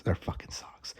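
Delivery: breathy whispered exasperation, no voice at all. Shown as a demonstration. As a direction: whisper it like a fed-up secret.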